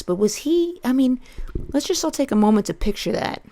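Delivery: normal talking voice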